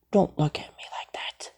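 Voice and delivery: unvoiced whisper. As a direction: breathe it out with no voice. Whispered aside.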